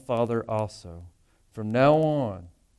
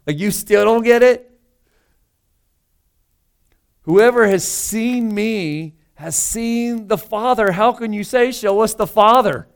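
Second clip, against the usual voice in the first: higher and louder, incredulous disbelief; exasperated; 8 semitones higher, 7 dB louder than usual. Shown as a demonstration. Jesus to Philip, openly exasperated and incredulous. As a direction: throw up your hands: are you kidding me. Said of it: "this is exasperation".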